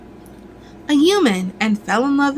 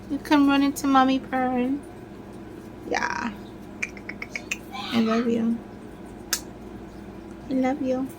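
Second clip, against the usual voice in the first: cooing to cat; 2 semitones higher, 10 dB quieter than usual. Real, not acted.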